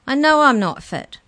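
high, loud breathless retort; puffing. A narrator's reading. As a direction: puff it out while hurrying. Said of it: "puffed Molly".

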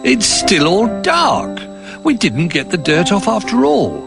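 high, slow dismayed little bear; little bear dismayed at the dark window; disappointed cry. A narrator's reading. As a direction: heartbroken their work failed. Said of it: "he cried".

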